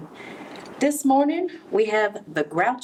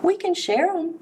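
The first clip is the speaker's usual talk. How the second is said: high, bright-voiced offer to share; sweet high friendly ladybug; friendly suggestion; 11 semitones higher than usual. A narrator's reading. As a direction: offer kindly in a high little voice. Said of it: "suggested the friendly ladybug".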